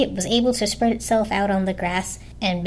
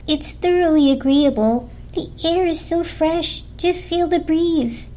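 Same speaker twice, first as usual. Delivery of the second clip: delighted blanket; 6 semitones higher, 3 dB louder than usual. A narrator's reading. Blanket praises the forest to the others, gushing. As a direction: swoon over the fresh air, then plead. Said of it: "the blanket declared".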